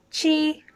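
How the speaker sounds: Chi held high and level; flat first tone. Shown as a demonstration. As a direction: say Chi on one high, steady note. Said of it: "it's first tone flat".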